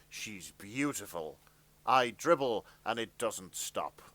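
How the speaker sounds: ordinary talk, nothing marked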